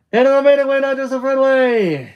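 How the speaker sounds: high, loud holler; shouted declaration. Acted. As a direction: holler it far out over the beach.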